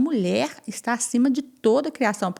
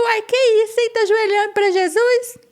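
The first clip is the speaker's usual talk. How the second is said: much higher, louder, jeering and amused; mocking laughter; 13 semitones higher, 5 dB louder than usual. Girls mocking the kneeling priestess, high jeering laugh. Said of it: "E as meninas olhou e riu".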